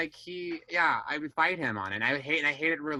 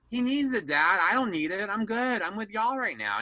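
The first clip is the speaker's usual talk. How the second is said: high-pitched defensive pleading; insistent protest; 9 semitones higher, 4 dB louder than usual. Retold or imitated. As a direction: argue back at Dad, voice pushed up. Retelling argument with Dad.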